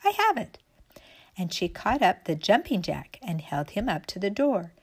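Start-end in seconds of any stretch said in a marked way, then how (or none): 0.1-0.6 very high burst of inspiration; sudden excitement. A narrator's reading. Raggedy Ann jumping up with an idea, excited high cry. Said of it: "jumped up and said".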